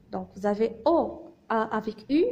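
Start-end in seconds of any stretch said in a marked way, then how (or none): none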